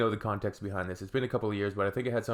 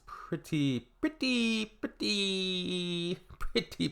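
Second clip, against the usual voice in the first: each pretty drawn out much higher, then drop to not good; stalling exaggerated pretty; 9 semitones higher, much slower than usual. Judging people who think Europe is two countries, comic stalling. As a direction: stretch the word pretty comically before landing. Searching for words on geography.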